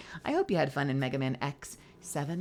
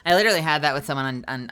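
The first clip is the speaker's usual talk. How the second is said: usual speaking voice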